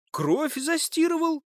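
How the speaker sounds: very high, slow, indignant question; incredulous echo. Acted. Suspect throws the accusation back, incredulous.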